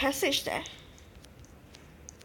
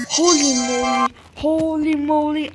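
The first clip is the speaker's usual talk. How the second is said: loud, wide-eyed holy moly; amazed exclamation; 9 dB louder than usual. Real, not acted. Player telling viewers about the find, loud amazement. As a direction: gasp and gush in amazement. Finds the hidden item.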